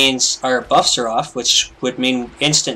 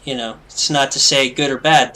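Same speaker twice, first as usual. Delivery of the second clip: usual speaking voice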